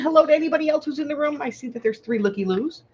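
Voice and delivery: bright high welcome; cheery greeting. Real, not acted. Greeting live viewers cheerily.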